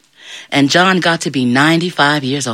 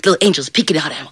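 plain reading voice

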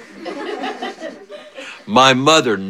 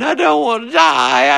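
high mock wail of a little brother; hammed-up kid's wail; imitated crying; 12 semitones higher than usual. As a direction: squeal it like a panicked, crying child. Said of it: "brother started crying".

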